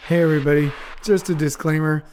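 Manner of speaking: usual speaking voice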